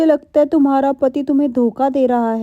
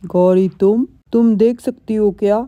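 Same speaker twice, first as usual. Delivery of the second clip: a lower, stunned gasp of a question; shocked; 3 semitones lower than usual. Acted. Husband caught by his wife, stunned. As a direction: caught out and stammering.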